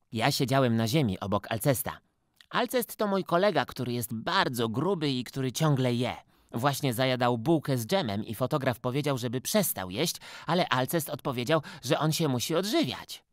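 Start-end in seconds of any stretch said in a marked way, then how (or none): none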